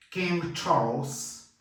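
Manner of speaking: King Charles said very slowly, Charles with the S; slow model pronunciation. Shown as a demonstration. Teacher slowly models King Charles for learners. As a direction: draw out King Charles clearly. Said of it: "E tem o S".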